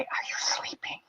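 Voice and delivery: soft breathy whisper, sneaking up on someone; tiptoeing whisper to a possibly sleeping husband; sneaky whisper. Retold or imitated. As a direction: whisper it so you don't wake him. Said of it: "I'd sneak in there and I'd say".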